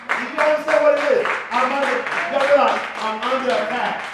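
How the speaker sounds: loud alarmed plea; alarmed outcry. Acted. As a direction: call it out loud and fast. Acted spouse under attack.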